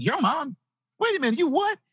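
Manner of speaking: high, incredulous squeak; shocked disbelief. Retold or imitated. Kid reacts to the banker's crack-addict mother, high and incredulous. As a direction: act out the kid's jaw-dropping surprise.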